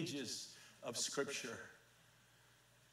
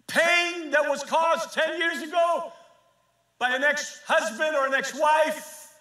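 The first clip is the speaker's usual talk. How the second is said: higher and louder, rising to a fervent climax; preaching at full force; 10 semitones higher, 8 dB louder than usual. Real, not acted. Preacher urging the congregation toward healing, fervent preaching. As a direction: push each phrase out, calling heaven down.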